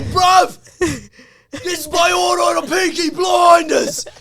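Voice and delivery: loud, high mock British accent yelled in the street; showing off a British accent in the middle of the street, yelling; British accent shout. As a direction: shout it like a Peaky Blinders Cockney lad. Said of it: "my fucking accents".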